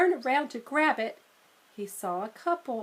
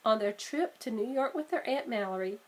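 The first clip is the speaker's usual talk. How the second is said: plain reading voice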